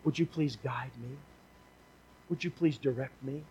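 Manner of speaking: soft, humble plea to God; a soft imagined prayer from a listener; hushed prayer. Pretend. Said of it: "or you'll say".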